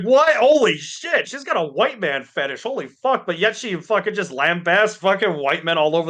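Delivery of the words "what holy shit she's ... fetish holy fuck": high-pitched retold disbelief; shocked reaction. Podcaster retelling reaction to a clip, shocked. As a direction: blurt it out high, astonished.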